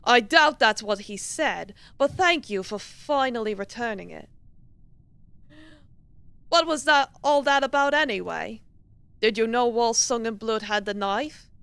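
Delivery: sceptical British professor; measured, put-on British man questioning; masculine British accent. Professor questions Miss Hagen about the knife, masculine British accent. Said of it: "masculine British accent".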